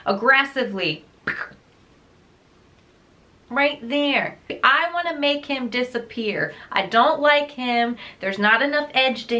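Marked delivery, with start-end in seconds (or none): none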